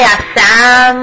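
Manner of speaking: ordinary talk, nothing marked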